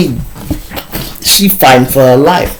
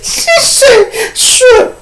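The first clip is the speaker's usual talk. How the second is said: squeaked out through laughter; laughing too hard to talk; much slower than usual. Real, not acted. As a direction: say it high, still cracking up.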